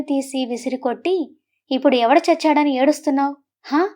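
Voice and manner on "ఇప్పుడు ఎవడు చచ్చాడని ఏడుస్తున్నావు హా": ordinary delivery, nothing marked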